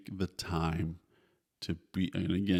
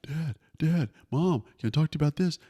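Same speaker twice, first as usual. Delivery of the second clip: high, quick imitation of a kid wanting attention; pestering kid; 9 semitones higher than usual. Kid interrupting dad on the couch, high pestering imitation. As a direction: mimic a child calling for parents, pitched up. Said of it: "your kids are like".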